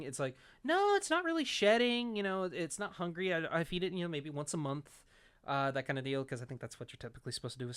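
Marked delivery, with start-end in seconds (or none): 0.6-5.0 high-pitched mock protest, easing off; owner's protest. Snake owner answering the vet's questions, protesting.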